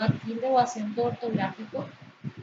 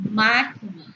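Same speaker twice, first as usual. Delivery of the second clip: "máquina" with má punched, the rest falling away; MÁ-quina, demonstrated for a class; stress on first syllable; 4 dB louder than usual. Shown as a demonstration. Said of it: "Acento prosódico en ma".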